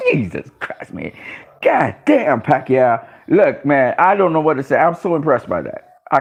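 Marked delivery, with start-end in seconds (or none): 0.1-1.1 whispered disbelief; awed hush. Real, not acted. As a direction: breathe it out stunned. Amazed at Pacquiao's age.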